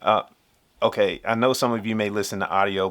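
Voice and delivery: ordinary delivery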